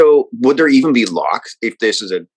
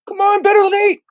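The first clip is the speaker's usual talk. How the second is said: much higher pleading cheer at dice; excited shout. Real, not acted. Player urging dice roll.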